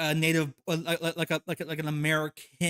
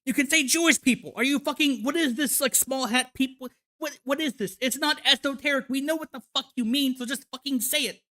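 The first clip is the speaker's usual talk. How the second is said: higher, exasperated yelling at the screen; fed up, demand they just say it; angry rant; 7 semitones higher than usual. Real, not acted. Reacting to a video creator dodging words, angry exasperated rant.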